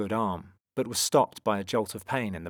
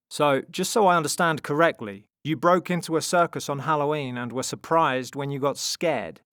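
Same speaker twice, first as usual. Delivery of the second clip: loud, higher mocking recap; teasing brother; 6 semitones higher, 7 dB louder than usual. A narrator's reading. Tom ribs his sister's friends, loud and teasing. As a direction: tease them with the summary.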